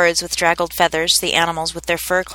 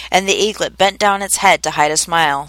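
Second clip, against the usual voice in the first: ordinary delivery, nothing marked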